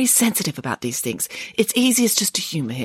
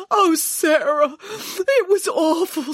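high, slow, crying lament; tearful wail; 10 semitones higher, much slower than usual. Acted. Boris cries to Sarah about his awful day. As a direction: moan it out in tears.

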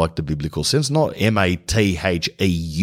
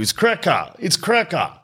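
lightly sending up "It's cracker", raised pitch; mock catchphrase; 10 semitones higher than usual. A podcaster satirizes a stupid trendy word with a mild mock voice. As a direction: parrot "It's cracker" like a silly slogan. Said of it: "satirizing that stupid word".

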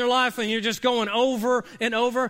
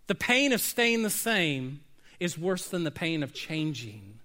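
ordinary talk

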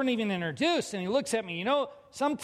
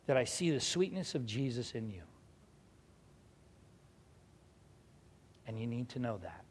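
low, soft, heartfelt retelling; hushed earnestness; 7 semitones lower, 7 dB quieter than usual. Retold or imitated. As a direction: let each phrase sink lower and softer. Old man's intense, crushing words retold.

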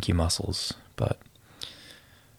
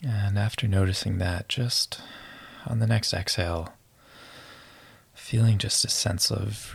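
guided meditation. Acted. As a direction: ease them into relaxing.

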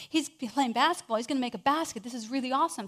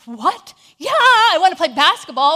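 loud, fast, thrilled yell; overjoyed kid finally asked to play; excited shout; 3 semitones higher, 13 dB louder than usual. Retold or imitated. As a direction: burst out with eager joy.

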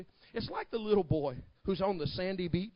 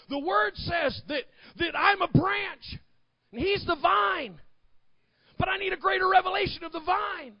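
full-force preaching; 13 semitones higher, 7 dB louder than usual. Acted. Preacher urging people not to settle, high impassioned shout. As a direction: call it out high and loud, stretching key words.

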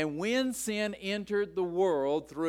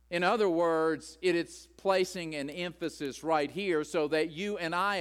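normal talking voice

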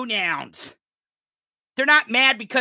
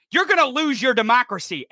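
usual speaking voice

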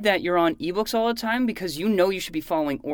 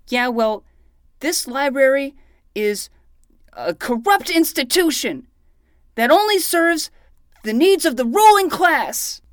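louder and higher, building indignation; defiant rant; 8 semitones higher, 9 dB louder than usual. Acted. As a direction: declaim it like a protest slogan. Defiant retort about library.

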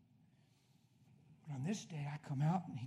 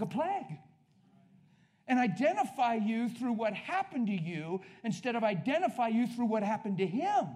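raised, insistent sermon voice; fervent preaching; 6 semitones higher, 6 dB louder than usual. Real, not acted. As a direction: hammer out each point to the congregation. Preaches at full force.

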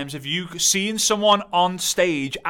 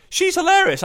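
much higher, delighted squeal; gushing fan; 13 semitones higher, 4 dB louder than usual. Retold or imitated. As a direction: gush like a new fan. Follower gushing about reel.

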